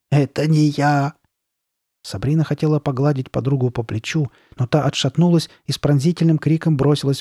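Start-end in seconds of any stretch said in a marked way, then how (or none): 0.1-1.1 slow, drawn-out, understated wail. A narrator's reading. Carly Beth clutching the mask, wailing. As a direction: lament it, stretching the words. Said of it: "причитала Карли Бет".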